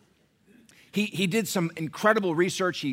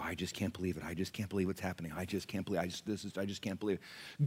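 softer, mumbled helpless tutting; mock hand-wringing; 10 semitones lower, 12 dB quieter than usual. Mocking Christians who shrug at the culture, soft tutting. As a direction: mimic a complacent churchgoer shaking his head. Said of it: "watch people ... and go".

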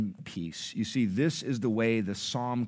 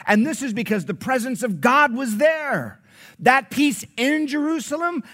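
high, loud, ringing sermon delivery; full-force preaching; 11 semitones higher, 7 dB louder than usual. Acted. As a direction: proclaim it to the whole congregation.

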